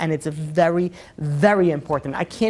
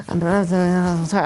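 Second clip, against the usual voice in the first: mock humble apology; much slower than usual. Mock humble reply to critic.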